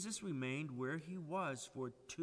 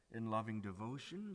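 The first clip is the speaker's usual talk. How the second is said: ordinary delivery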